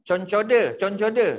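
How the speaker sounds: "Concoda" dengan loghat Kelantan. Retold or imitated. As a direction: sebut "Concoda" gaya pakcik Kelantan. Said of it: "Sabri Yunus berlakon". (sebutan Kelantan "Concoda" bagi Concorde)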